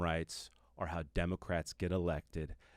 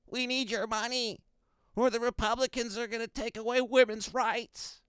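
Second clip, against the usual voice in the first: high mock pleading politician voice; hammed-up high begging plea; mocking Democrats; 3 dB louder than usual. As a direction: beg for votes in a high whiny voice. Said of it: "they hold out their hats and they say".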